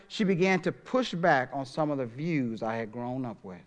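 ordinary talk

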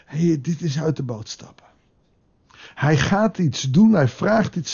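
usual speaking voice

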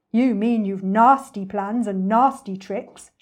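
slow, loud accusation; big-voiced disapproving elephant; indignant elephant. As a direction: scold slowly, leaning on each word.

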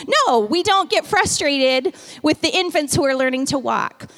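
ordinary talk, nothing marked

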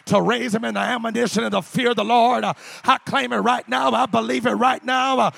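loud, sung-out preaching over music. Acted. Preacher pleading with God for his children, full-voiced preaching. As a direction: preach it out full force to the church.

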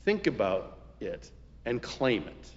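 ordinary talk, nothing marked

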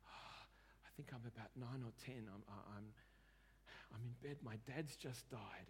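soft, subdued voice for a grieving memory; upset, retold. Client recalls his dad's death to counsellor, quietly upset. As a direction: quietly imitate a distressed client, halting. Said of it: "he was really upset".

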